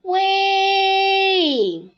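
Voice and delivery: long high call into a valley. Acted. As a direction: shout hello across the mountains. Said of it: "喊了一声".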